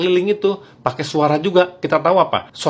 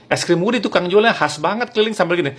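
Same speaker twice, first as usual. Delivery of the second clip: normal talking voice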